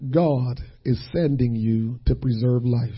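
soft and low; quiet assurance. Acted. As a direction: settle it softly. Quiet affirmation after questions.

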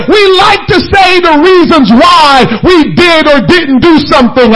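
preaching to the congregation at full force; preaching shout. Real, not acted. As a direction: hammer the refrain home.

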